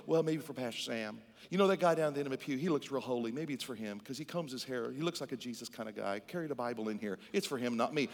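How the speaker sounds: low, quiet mumbled excuse; self-excluding doubter. Acted. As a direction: play a hesitant churchgoer passing it off to someone else, low and soft. Voices a doubting church member deflecting.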